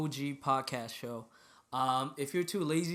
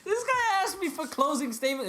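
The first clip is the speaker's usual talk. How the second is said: laughing; 9 semitones higher, 3 dB louder than usual. Real, not acted. Laughing at co-host.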